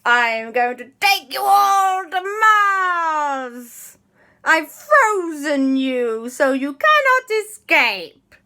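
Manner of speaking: high, loud, very slow alien proclamation; villainous Martian. Acted. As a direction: stretch every word like a gloating space invader. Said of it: "And the Martian went".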